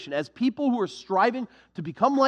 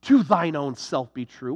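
pompous mock recital; 7 semitones lower than usual. Mocking the quote pompously.

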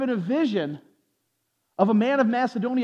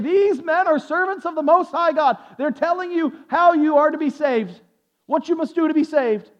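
higher, louder proclamation; slave girl's cry; 6 semitones higher, 4 dB louder than usual. Acted. Slave girl following the apostles all day, calling out. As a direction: call it out as a repeated public announcement.

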